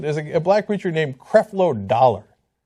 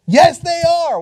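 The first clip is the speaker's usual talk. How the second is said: emphatic; 12 semitones higher, 6 dB louder, slower than usual. Real, not acted. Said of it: "emphatic reply".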